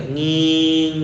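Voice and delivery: long, held sound-system squeal; imitated feedback whine. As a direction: mimic a microphone's drawn-out ringing. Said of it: "sound systemnya bunyi sikit ya". (tiruan dengung sound system yang panjang)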